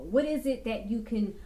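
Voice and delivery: normal talking voice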